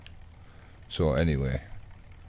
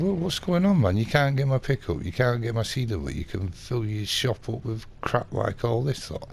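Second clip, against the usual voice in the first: higher-pitched retold indignation; indignant complaint; 8 semitones higher than usual. Customer confronts the dealer over missing orders, indignant. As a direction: act out having a go at the dealer.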